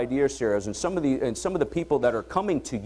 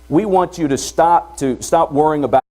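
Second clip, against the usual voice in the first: emphatic; 3 semitones higher, 10 dB louder than usual. Real, not acted. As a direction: lean hard on the call to stop. Urging the audience to change.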